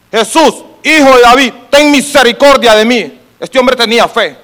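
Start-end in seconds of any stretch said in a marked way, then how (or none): 0.1-3.2 raised, projected plea for mercy; crying out. Acted. Blind man keeps calling Jesus despite being hushed, acted crying out. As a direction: act the beggar calling out over the crowd. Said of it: "y empezó a clamar".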